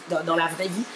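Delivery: ordinary delivery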